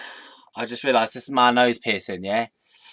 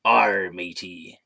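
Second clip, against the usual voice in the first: put-on slow, lower pirate accent; 5 semitones lower, much slower than usual. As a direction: growl it out like a pirate. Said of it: "in the accent realm".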